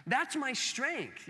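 usual speaking voice

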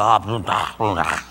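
low, grumbling brush-off; dismissive blah blah. Retold or imitated. Wife brushing off the call to love husband, low mock grumble. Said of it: "and you go".

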